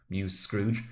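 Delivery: ordinary delivery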